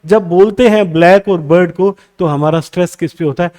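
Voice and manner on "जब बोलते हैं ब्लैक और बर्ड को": usual speaking voice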